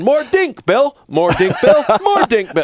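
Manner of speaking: put-on very high, loud little-kid chant; toddler demanding. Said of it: "he would sit there for weeks just going".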